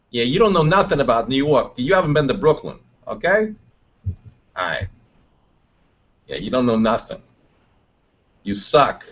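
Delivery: put-on light tough Brooklyn accent. Light Brooklyn accent, scolding someone who's never been there. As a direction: talk back like a Brooklyn guy. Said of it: "You thought my New York accent was terrible".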